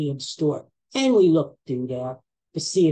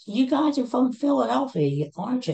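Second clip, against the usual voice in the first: high-pitched friendly waitress quote; imitated waitress; 8 semitones higher than usual. Waitress asking diners where they're from, imitated high voice. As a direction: lift the voice high to mimic the waitress. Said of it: "she says to us".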